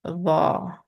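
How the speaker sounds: "La" as a thickened th from "this"; thick, heavy sound. Shown as a demonstration. Teacher repeats the example for learners, heavy sound. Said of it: "but it's thick and heavy".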